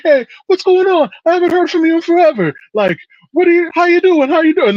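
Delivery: put-on high, loud, excited mother greeting the boys; fussing Italian mom. Mother greeting her son's friends late at night, high and gushing. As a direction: gush over the boys like a doting Italian mama. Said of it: "she's like".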